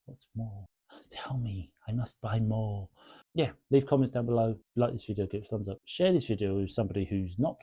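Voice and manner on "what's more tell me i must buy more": hushed greedy whisper. Pretend. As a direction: whisper it like an addict craving more. Greedy whispered craving for more.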